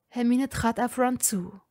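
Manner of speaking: ordinary delivery, nothing marked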